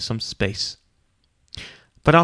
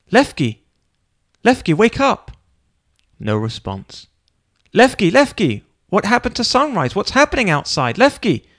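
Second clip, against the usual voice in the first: high, loud frantic calls; panicked calling; 8 semitones higher, 5 dB louder than usual. A narrator's reading. Feldman trying to wake his friend, high frantic calls. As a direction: shout in rising panic.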